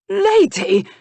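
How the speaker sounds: very high, loud scornful bark; spat out. Acted. Nephew mocks the word lady at his uncle, scornful spat bark. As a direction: spit the word like poison. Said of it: "He spat the word out".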